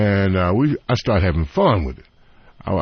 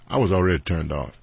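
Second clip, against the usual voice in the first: ordinary talk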